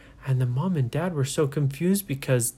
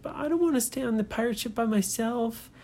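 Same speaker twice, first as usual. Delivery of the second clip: put-on higher, timid little girl voice; reluctant girl not wanting to go; scared little girl; 10 semitones higher, faster than usual. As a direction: shrink back, a nervous girl. Nora pleading, voiced high.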